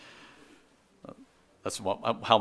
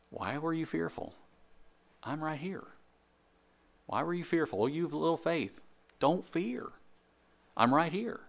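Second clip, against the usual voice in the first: gentle Jesus voice; 5 semitones higher, 5 dB quieter than usual. Acted. Voicing Jesus reassuring disciples.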